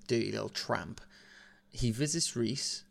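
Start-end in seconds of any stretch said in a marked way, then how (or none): none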